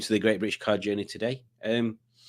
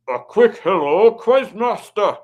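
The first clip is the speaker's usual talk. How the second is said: slow, loud, higher-pitched attempt at Scottish; mock Scottish accent; 8 semitones higher, 7 dB louder, slower than usual. Livestream host reads Colin's greeting in a mock Scottish accent. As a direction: put on a drawn-out, hammy Scottish accent. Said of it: "which is not Colin's accent".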